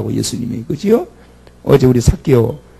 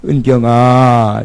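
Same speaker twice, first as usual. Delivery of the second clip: slow tender call; 2 semitones lower, 3 dB louder, much slower than usual. Acted. God calling a name.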